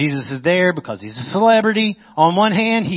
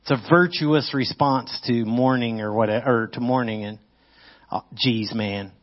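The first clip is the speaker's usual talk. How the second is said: ordinary talk, nothing marked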